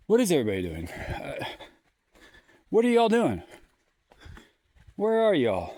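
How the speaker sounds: loud, high call into the empty woods. Real, not acted. Alone in the forest, calling for everybody, loud and high. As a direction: shout out for anyone around.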